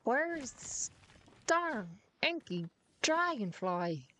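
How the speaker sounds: exaggerated Irish-American lilt, high and slow; mock Irish-American accent. Gamer hunting a bug while mocking sister, hammed-up Irish-American accent. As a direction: put on a drawn-out, teasing Irish-American twang. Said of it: "I am making fun of you".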